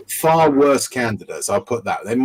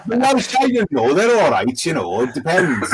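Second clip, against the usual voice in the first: sing-song, higher-pitched Welsh lilt; Welsh accent; 9 semitones higher than usual. Acted. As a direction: put on a lilting Welsh accent. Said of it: "do a Welsh accent".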